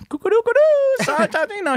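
put-on very high, singsong baby-talk babble; imitated doting grandma. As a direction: coo nonsense like a grandma to a toddler. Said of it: "and my mom is".

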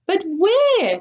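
high-pitched anxious cry; shrieked. A narrator's reading. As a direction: shriek the question in alarm. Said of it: "shrieked Missus Salt".

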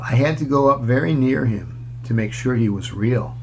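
usual speaking voice

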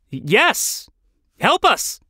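high, eager cry for help; excited plea. Acted. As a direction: call out urgently to a rescuer. Calling to a possible rescuer.

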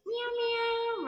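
high squeaky meow, meow; cat meow. Shown as a demonstration. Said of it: "What does the cat say?".

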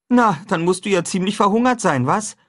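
put-on high, lively boyish voice; a cheerful boy proposing breakfast; boy talking brightly to his friend; young boy Huck. A narrator's reading. Huck's voice.

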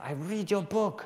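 high, slow imitation of an Argentinian fan; Argentinian accent. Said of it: "Sorry about the accent".

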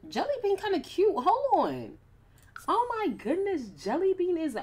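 high, loud squeal over a cute pet; shrieking with delight at Jelly Bean; squealing excitement. Real, not acted. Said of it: "I sound like a bird".

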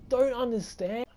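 ordinary delivery

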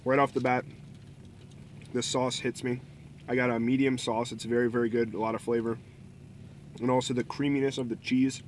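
speaking between bites; talking while chewing. Real, not acted. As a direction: mouth partly full of food. Seen 0: Bites wrap just before; jaw working, lips full, wiping mouth while talking.

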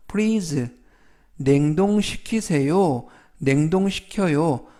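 usual speaking voice

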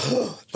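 high, quick intake of breath; mock-astonished gasp while retelling; awed gasp. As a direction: gasp as if thrilled by the offer.

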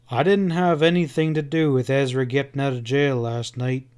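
plain reading voice

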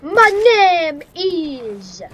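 loud, slow, grand declaration; dramatic hero. Pretend. Gamer quoting The Matrix, slow loud declaration. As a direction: announce it like a movie hero.

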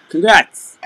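strained, high, loud burst; too loud. Real, not acted. Seller congratulating a buyer while losing her voice, too loud. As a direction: push a failing voice too hard. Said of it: "trying to speak too loudly".

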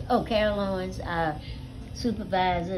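normal talking voice

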